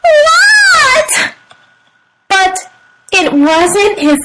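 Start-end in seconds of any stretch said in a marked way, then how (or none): 0.0-1.1 high, stretched squeal; startled reply. A narrator's reading. Froggy answers his teacher's call, startled squeal. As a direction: squeal it back in surprise.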